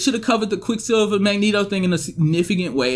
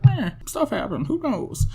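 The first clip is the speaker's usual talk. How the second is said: tag shrug; 8 semitones higher, 5 dB quieter than usual. Acted. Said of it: "it's just like".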